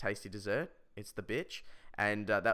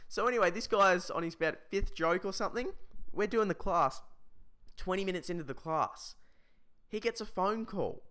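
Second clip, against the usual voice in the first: usual speaking voice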